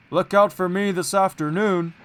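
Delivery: loud, proud big engine; boastful Gordon. A narrator's reading. Gordon boasting to little Edward, loud and proud. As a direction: boast down at him. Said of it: "said Gordon".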